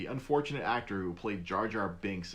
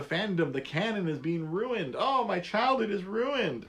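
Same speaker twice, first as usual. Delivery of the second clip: mock whiny fan; 9 semitones higher than usual. Mocking whiny fans.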